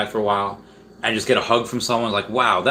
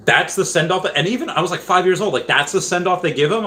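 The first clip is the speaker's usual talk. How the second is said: incredulous; 8 semitones higher than usual. Retold or imitated.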